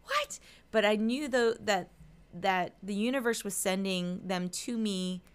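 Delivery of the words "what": high, loud stunned "What? What?"; shocked what. Retold or imitated. Retelling the surprise of strangers seeking her out, shocked high yelp. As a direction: disbelief shooting up high and loud. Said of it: "And I'm like".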